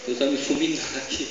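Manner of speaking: mock-scared, breath-only start, then a low worried murmur; Sara. Sara caught laughing by God, mock frightened aside. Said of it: "Sara ficou com medo".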